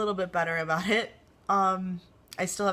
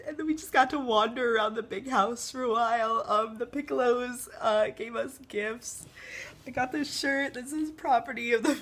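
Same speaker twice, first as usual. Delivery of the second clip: higher, teary voice held through the telling; still tearful; 5 semitones higher than usual. Real, not acted. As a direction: still choked up, fighting tears. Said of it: "a video in which I cry".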